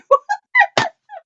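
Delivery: high, loud squealing laugh; hard laughter. Real, not acted. Host refusing to finish the joke, high squealing laugh. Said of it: "I'm laughing so hard".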